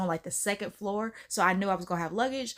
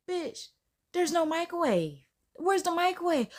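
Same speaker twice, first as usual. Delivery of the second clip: much higher, outraged discovery; indignant; 8 semitones higher than usual. Retold or imitated. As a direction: demand where it is. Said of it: "I was just like".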